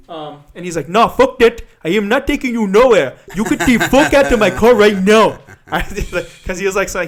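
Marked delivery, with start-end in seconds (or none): 0.9-5.4 loud, high African-accented mock anger; heavy African accent. Driver throwing out a passenger, heavy African accent. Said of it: "a really heavy African accent".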